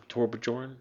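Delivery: "Torbjorn" sounded out with an English J, tor-buh-JORN; mistaken pronunciation. Shown as a demonstration. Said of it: "how do you pronounce this".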